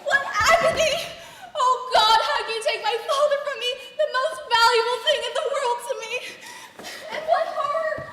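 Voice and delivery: loud, high melodramatic lament with laughter breaking through; mock tragic outcry; hammed-up grief. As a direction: wail over the top and crack up. Daughter overacts grief for father.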